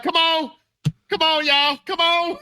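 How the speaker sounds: high, pleading call to hurry; retold urgent calling. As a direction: urge the ambulance on, acted out. Retelling calls to the ambulance.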